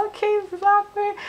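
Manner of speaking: very high, uneasy squeak; retold awkward, high-pitched aside; awkward reaction. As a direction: say it way up high, as if cornered. Her awkward reaction to fawning.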